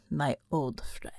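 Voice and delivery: low, slow whisper. Acted. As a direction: breathe it out. Devil speaks softly.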